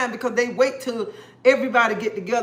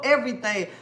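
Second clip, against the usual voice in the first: normal talking voice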